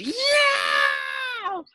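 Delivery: very high loud shriek; piercing scream. Acted. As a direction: scream out of nowhere. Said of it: "Leslie screamed".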